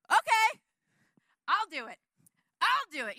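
high, loud, bursting to go; eager volunteer. Acted. David volunteers to fight Goliath, loud and eager. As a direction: jump at the chance like a cocky kid. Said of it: "David's just like".

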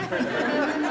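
short airy burst of laughter; breathy laugh at her own predicament; real laugh. Real, not acted. Laughs at being stuck in desert.

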